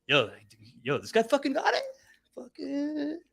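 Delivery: hammed-up hype guy at the gym; gym bros hyped. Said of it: "other people at the gym were like".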